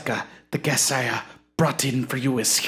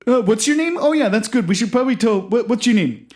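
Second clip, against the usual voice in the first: much higher and louder, welcoming; high eager host voice; 7 semitones higher, 5 dB louder, faster than usual. Acted. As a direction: usher the guest in brightly.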